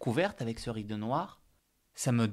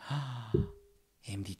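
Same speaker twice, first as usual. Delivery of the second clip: ordinary talk, nothing marked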